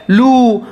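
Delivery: teacher shows learners the long vowel, "लू" with a drawn-out ऊ. Shown as a demonstration. Teacher explaining the vowel mark to learners, long ऊ in "लू". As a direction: say "लू" with the ऊ held long. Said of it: "ऊ को लंबा करके बोलना होगा".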